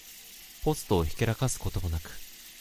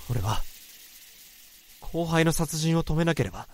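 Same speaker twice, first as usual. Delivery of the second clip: ordinary talk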